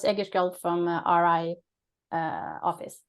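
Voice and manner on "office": normal talking voice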